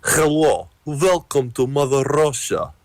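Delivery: high, cartoonish Russian accent. Shown as a demonstration. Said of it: "with a Russian accent".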